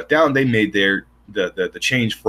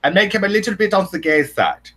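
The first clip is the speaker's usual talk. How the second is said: lightly put-on German accent, higher; a hint of a camp German accent; playful mock German accent; German Nazi accent; 8 semitones higher than usual. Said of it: "a stereotypical German Nazi accent".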